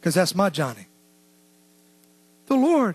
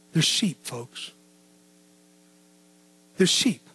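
much softer and lower, almost spoken to himself; hushed quotation; 7 semitones lower, 7 dB quieter, slower than usual. Acted. Preacher repeating Jesus' words to the church, hushed. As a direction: let it fall to a near hush.

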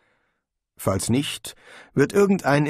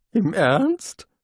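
incredulous; 9 semitones higher than usual. Acted. Rincewind stunned by Konina.

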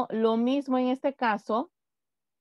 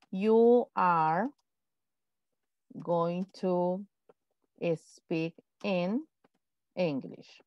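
slow, spaced words with long pauses; slow dictation; 4 semitones lower, 3 dB quieter, much slower than usual. Shown as a demonstration. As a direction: dictate it word by word. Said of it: "yo digo". (dictado lento, palabra por palabra)

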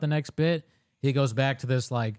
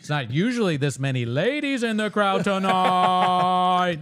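slow, louder, higher showman patter; mock stand-up crowd work; 5 semitones higher, 4 dB louder, slower than usual. Mocking a comic, drawn-out crowd flattery. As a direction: ham up the cheesy comic working the room.

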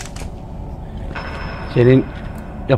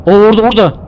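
startled; 7 semitones higher, 5 dB louder, faster than usual. Real, not acted. Spots something in horror game.

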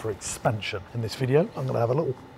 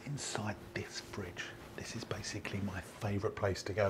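secretive whisper; 4 semitones lower, 17 dB quieter than usual. Real, not acted. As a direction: confide it in a hush. Confiding a secret favourite fridge spot.

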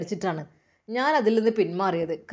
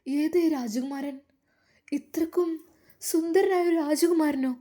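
higher, slow amazed wonder; astonished gasp; 6 semitones higher than usual. Acted. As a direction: marvel at the handsome prince. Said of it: "മാഷിത്ത ഞെട്ടിപ്പോയി". (അത്ഭുതത്തോടെ ഉയർന്ന ശബ്ദം)

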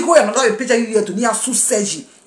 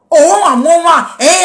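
loud, higher, punching each word; loud counting; 5 semitones higher, 5 dB louder than usual. Real, not acted. Counting off to the critics, loud and high. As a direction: count it off like a challenge.

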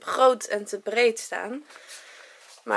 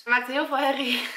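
ordinary talk, nothing marked

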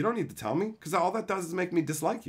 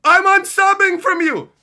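much higher, louder and slow; mocking viewer; 14 dB louder than usual. Streamer mocking an upset unsubscriber, high slow whine. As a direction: drag out a whiny mock announcement. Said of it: "Somebody goes".

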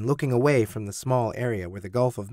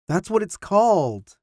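higher-pitched, insistent protest; 8 semitones higher, 4 dB louder than usual. A narrator's reading. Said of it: "Tim insisted".

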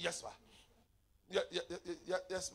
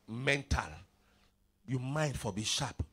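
ordinary talk, nothing marked

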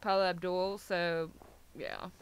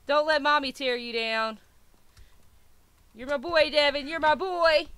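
much louder, proud cheer for the dog; loud pet praise; 4 semitones higher, 9 dB louder than usual. Real, not acted. Cheering on her pet Devin.

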